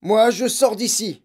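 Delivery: high, loud, frightened outburst; flustered panic. A narrator's reading. Father fleeing the ghost, flustered. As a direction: blurt it out in a panic. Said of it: "bafouilla le père".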